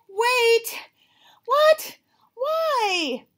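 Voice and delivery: high, loud startled cries; shocked outburst. Acted. Gerald learns the surprise is not here, high loud shock. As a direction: fire off alarmed questions.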